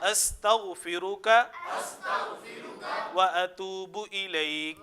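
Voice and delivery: very slow, drawn-out Arabic supplication; measured teaching chant, phrase by phrase; repeat-after-me recitation. Shown as a demonstration. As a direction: stretch each phrase for students to repeat. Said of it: "کفارہ مجلس کی دعا پڑھتے ہیں".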